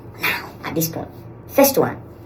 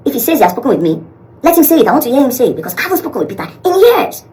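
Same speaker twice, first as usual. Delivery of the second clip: agitated insisting; 12 semitones higher, 9 dB louder than usual. Retold or imitated. Retold man insisting, agitated.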